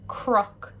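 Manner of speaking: crook said /kʰrʌk/ with cr for written cn; crook, Ulster cr sound; Irish pronunciation. Shown as a demonstration. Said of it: "it gets pronounced like cr".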